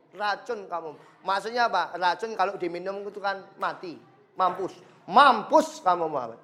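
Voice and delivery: ordinary talk, nothing marked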